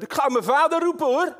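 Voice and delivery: put-on high, loud childish playground taunt; mock a kid threatening to fetch dad; taunting schoolkid. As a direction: kind op het schoolplein dat dreigt met zijn vader.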